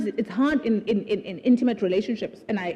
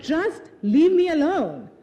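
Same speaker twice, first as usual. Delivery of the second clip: weary plea; 6 semitones higher, 4 dB louder, slower than usual. Retold or imitated. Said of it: "Sometimes I'm like".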